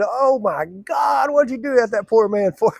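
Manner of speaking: loud high slow laughing cry; laughing shout. Retold or imitated. Joe scolds his friend, loud laughing shout. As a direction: holler through laughter.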